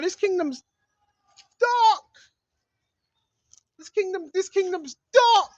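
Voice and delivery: ordinary talk, nothing marked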